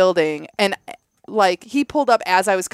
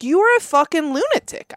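high, retold insult; telling him off; 7 semitones higher than usual. To the neighbor's face, indignant telling off. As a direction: call him a lunatic to his face. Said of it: "I said to his face".

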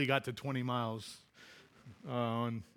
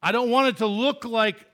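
louder, higher commanding voice of God; God speaking; 6 semitones higher, 7 dB louder than usual. Acted. God making a point to His people, firm. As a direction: declare it with authority.

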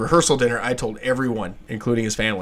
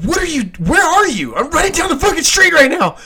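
high, frantic yells of a scared friend; frantic plea for a ride, high and loud; panicked yelling; 7 dB louder than usual. Retold or imitated. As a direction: shout it like you're running for your life. Friend's panicked call retold.

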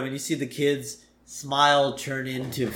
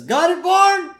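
high, loud call to someone below; mock yell, pitched way up; 7 dB louder than usual. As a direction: holler down from a window. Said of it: "he's yelling down".

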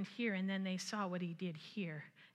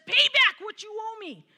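loud, higher demanding shout; 11 semitones higher, 13 dB louder than usual. Acted. Servant loudly demands his fellow servant pay. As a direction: bark the demand at him. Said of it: "he demanded".

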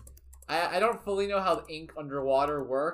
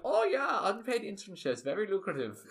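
put-on high German-accented approval; Octo; 6 semitones higher than usual. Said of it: "so Octo is kind of like".

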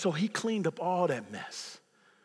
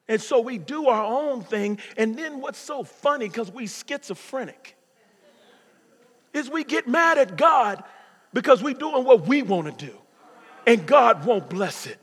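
louder, higher sermon build; rising preaching; 5 semitones higher, 8 dB louder than usual. Acted. Pastor rousing the congregation, building preaching. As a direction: build the fire back up.

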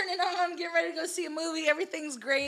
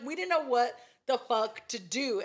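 normal talking voice

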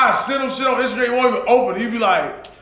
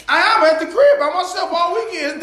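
laughing mock whine; 7 semitones higher than usual. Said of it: "You be like".

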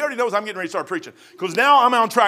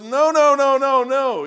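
repeated no, higher and louder, fading; emphatic no; 6 semitones higher, 6 dB louder, slower than usual. Retold or imitated. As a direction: protest he got it wrong. Colleague protests emphatically.

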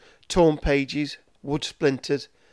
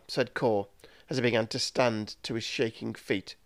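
ordinary delivery, nothing marked

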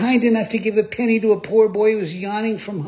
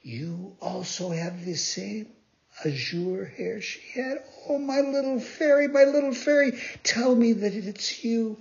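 tearful plea; 9 semitones higher than usual. A narrator's reading. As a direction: beg her to be the fairy. Pinocchio begs the fairy.

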